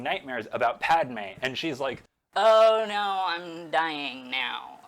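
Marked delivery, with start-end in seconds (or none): 2.3-4.8 hammed-up, drawn-out dying moan; mock dying wail. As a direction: wail like a melodramatic dying heroine. Hams up Padme dying in nightmare.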